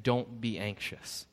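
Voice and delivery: soft, slow and gentle; tender reassurance. Shown as a demonstration. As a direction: say it like comforting a child. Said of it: "That's the way I want us to hear this statement".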